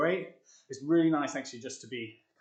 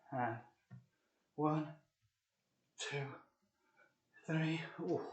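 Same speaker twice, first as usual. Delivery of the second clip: straining; 3 semitones higher, 7 dB quieter, slower than usual. Real, not acted. Straining mid push-up set.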